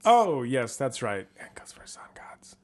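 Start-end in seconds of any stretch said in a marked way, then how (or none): 1.4-2.6 hushed conspiratorial whisper. Pretend. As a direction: whisper it like a secret. Secret sun gods hushed aside.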